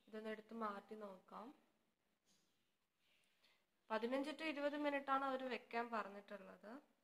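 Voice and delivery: talking while peeling mask; wearing a sheet mask. Real, not acted. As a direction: mask edge near lips. Seen 4: Wet sheet mask covers face; peeled from chin near end.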